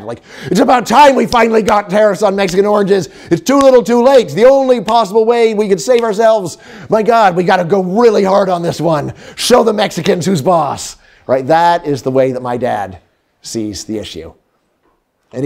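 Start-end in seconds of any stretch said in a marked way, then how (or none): 0.5-11.0 dad's rant. Retold or imitated. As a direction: play a worked-up father sure he's right. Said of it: "someone like my dad".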